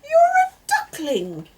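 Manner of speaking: high startled cry. Acted. Mister Rabbit crying out at the duckling. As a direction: shriek it in shock.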